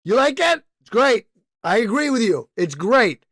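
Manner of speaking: loud, high, cocky press answer; brash spokesman. Retold or imitated. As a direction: brush off the question proudly. Brand answering press conference.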